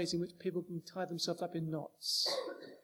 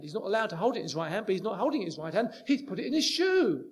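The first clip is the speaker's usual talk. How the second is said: rapid mock legalism; 5 dB louder, much faster than usual. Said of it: "legalist loophole mockery".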